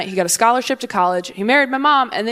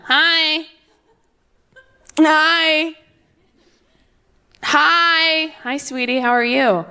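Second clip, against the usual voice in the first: put-on baby greeting; 5 semitones higher, much slower than usual. Mimicking a waving baby.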